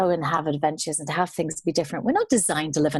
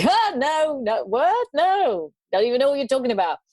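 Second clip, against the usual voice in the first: higher-pitched mock bafflement; baffled stranger; 7 semitones higher than usual. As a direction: retell a local dismissing an odd question, baffled. Retold stranger baffled by waterfall question.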